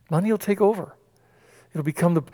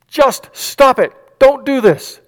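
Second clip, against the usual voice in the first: much higher, slow drawn-out plea; pleading demand; 9 semitones higher, 6 dB louder, slower than usual. Acted. Pharisees pressing Jesus, a slow high desperate plea. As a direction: beg and order at once, leaning on stop.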